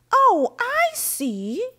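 usual speaking voice

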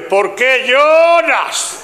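high, hugely drawn-out exasperated question; sin cariño alguno. Acted. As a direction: stretch every word as a fed-up, unloving husband. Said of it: "Viste que aquí lo de cariño se jodió". (marido harto, voz alta y alargada)

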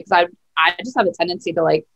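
ordinary talk

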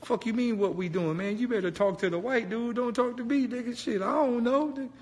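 a high panicky deflecting voice; high mock friend voice.

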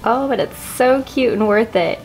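usual speaking voice

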